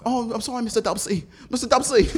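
high-pitched apologetic British imitation; mock British accent. Imitating how an actor really sounds, high mock British. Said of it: "Snowfall, that's how he really sounds".

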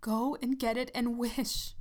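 trembling, eager urging; quivering excitement. Acted. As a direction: urge him on, shaking with excitement. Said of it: "quivering with excitement".

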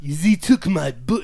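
slow mock-German grandpa accent; German accent drifting toward French; bad German accent. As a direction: imitate a botched German accent, slowly. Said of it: "German accent you've ever heard".

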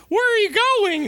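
high squeaky Minnie voice. Acted. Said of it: "I wanna see Minnie, like".

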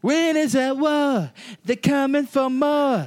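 slow, high, sing-song show intro; hammed-up announcer. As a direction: announce it like an epic reality-show opener.

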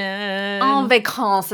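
slow mock French En vacances; French phrase. Shown as a demonstration. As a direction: drawl En vacances with a hammed-up French accent. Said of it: "as they say in French".

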